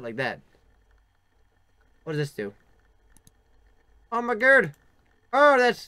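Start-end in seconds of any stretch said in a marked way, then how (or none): 4.1-5.9 high, loud "oh my god" of astonishment; surprised shout. Real, not acted. Player startled by a strange game screen, shouting in surprise. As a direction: blurt it out, startled and thrilled.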